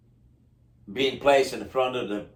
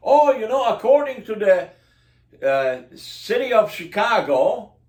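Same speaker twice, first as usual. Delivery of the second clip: mock official; 6 semitones higher, 3 dB louder than usual. Mocking city employee answer.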